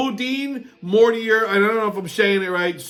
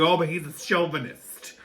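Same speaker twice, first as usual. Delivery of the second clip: mocking women's objection; 2 semitones lower, 4 dB quieter than usual. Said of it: "I have for you broads out there".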